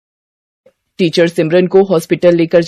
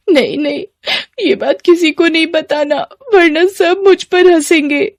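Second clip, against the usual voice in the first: high, crying plea; tearful pleading; 9 semitones higher than usual. Acted. Crying girl begging her friend not to tell anyone, tearful. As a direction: beg through tears for secrecy.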